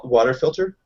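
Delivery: ordinary delivery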